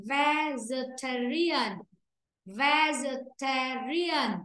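Vegetarian, very slowly, stress on ta; slow model pronunciation. Shown as a demonstration. Teacher rereading the word slowly to pupils. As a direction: drag it out syllable by syllable. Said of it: "Cô đọc lại này".

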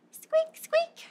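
high squeaky mouse squeak. Shown as a demonstration. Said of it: "So for the mouse, we'll say".

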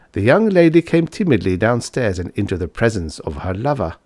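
ordinary delivery

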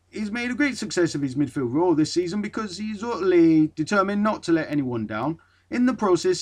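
commit to a mock Geordie accent, "bloke" said with a rounded Geordie vowel. Retrying the teammate's quote in a better mock Geordie accent. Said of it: "let's see, I'm trying anyway".

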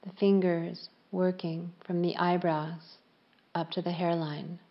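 calm yoga nidra voice with long pauses; unhurried, evenly pitched relaxation guidance; guided meditation. Real, not acted. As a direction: guide them gently into stillness, leaving space after each phrase.

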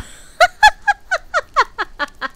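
high-pitched burst of laughter; high squealing laugh. Real, not acted. Vtuber cracks up at a friend, squealing laugh.

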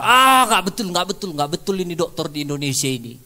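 loud, high, indignant outburst; shouting, refusing to believe it; angry denial. Retold or imitated. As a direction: rage against the diagnosis as if insulted. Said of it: "bapaknya marah".

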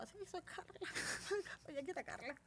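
tiny high voice, very soft; small, hushed mock-timid voice; meek self-introduction. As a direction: squeak it out sheepishly with a little laugh. Retold meek self-introduction, laughing.

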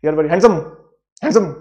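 "handsome" squashed into one abrupt burst; mock compliment, "handsome" run together; no pause. Shown as a demonstration. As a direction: blurt "handsome" with no break inside, like an insult. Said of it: "बिना pause के बोला जा सकता है".

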